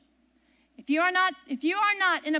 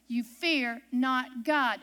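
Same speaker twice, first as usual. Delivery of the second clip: slow emphatic scripture; 3 semitones lower, much slower than usual. Shown as a demonstration. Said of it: "Do you hear that".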